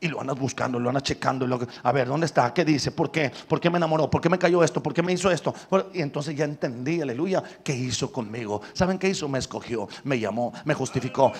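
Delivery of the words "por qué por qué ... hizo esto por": normal talking voice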